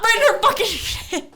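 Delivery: high, slow, laughing through it. Real, not acted. Telling friends about the punch, laughing.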